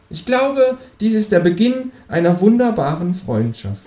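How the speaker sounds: high, grand kangaroo. Acted. The kangaroo quotes Casablanca in a high voice.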